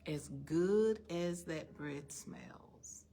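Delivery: quiet, determined vow to yourself; quiet resolve. Real, not acted. Preacher tells herself in the car she won't eat bread, a quiet firm vow.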